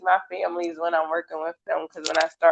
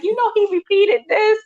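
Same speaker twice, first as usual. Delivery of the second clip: pitched way up, eager report; high excited parent voice. Retold or imitated. Therapist mimicking a proud parent, high voice. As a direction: tell proudly what he said.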